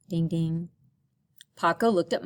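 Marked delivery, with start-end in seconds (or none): none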